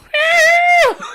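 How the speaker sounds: high, loud hair-band scream; rock scream. Acted. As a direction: wail it out like a rock singer.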